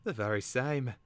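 high-pitched, voiced stage whisper; whispering bird. A narrator's reading. Caterbird confirming itself to Twig, stage-whispering. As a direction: put on a high creature voice. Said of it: "the Caterbird whispered".

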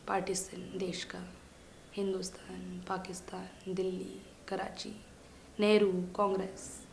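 very soft, low recital; hushed listing. Acted. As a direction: murmur the names one by one. Dismissively reciting the teacher's political words.

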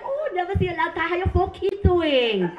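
high imitation of a Vietnamese nail lady; Vietnamese accent. Nail lady greeting a returning client, high Vietnamese accent. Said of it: "I love their accent".

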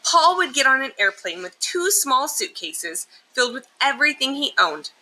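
usual speaking voice

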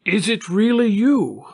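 high, incredulous question; wonderstruck, voice pitched well up; astonished recognition. A narrator's reading. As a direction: ask in sheer amazement. Long-lost acquaintances recognize each other.

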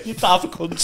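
laughing mock insult.

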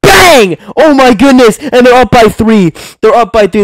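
high loud cry of joy at the go-ahead shot; thrilled yell. Real, not acted. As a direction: explode with delight as they take the lead. Team takes the lead, commentary.

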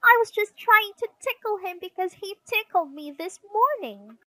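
put-on high, loud child's excuse; high-pitched squabbling self-defence; sister protesting. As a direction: pour out the excuse indignantly. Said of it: "protested Sister".

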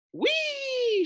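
very high drawn-out squeal; gleeful whee. A narrator's reading. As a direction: let it soar with delight. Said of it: "she said".

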